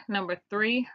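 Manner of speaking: ordinary delivery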